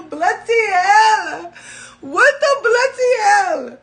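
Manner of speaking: loud, high, drawn-out British cry of pain; London accent outburst. Real, not acted. Nail struck by a crystal, loud London-accent cry.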